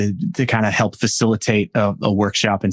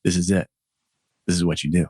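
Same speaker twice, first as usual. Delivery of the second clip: inner voice; 5 semitones lower, faster than usual. Retold or imitated. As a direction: lightly voice the calm voice inside. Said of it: "I heard a voice go".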